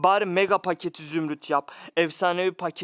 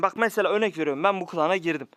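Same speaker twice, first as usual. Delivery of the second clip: ordinary talk, nothing marked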